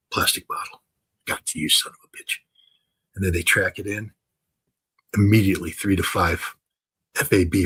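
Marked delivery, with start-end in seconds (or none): 0.1-2.4 near-whispered, as if spotting prey; acting a quiet, unseen hunter's discovery; hushed gotcha. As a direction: murmur it low, locking onto a target. Acted drone operator spotting a target.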